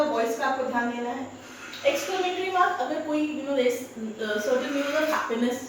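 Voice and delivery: ordinary talk, nothing marked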